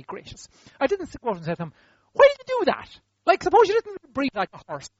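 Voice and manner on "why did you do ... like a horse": high, loud incredulous questioning; mock exasperation. What he never said to the prophesier, mock exasperation. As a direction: challenge them in mock disbelief.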